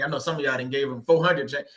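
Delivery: normal talking voice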